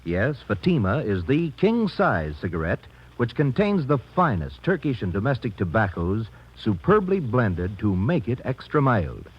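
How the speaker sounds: ordinary talk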